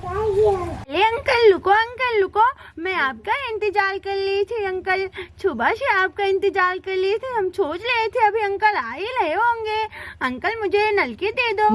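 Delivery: put-on lisping baby-talk child voice; childish, babyish begging; eager little child. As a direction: plead like a small child flagging down a seller.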